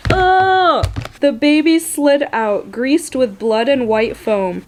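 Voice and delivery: high loud exclaimed oh carried into the line; shocked outburst. Real, not acted. Reader exclaims as the baby slides out, high loud shocked outburst. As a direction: burst out in shock.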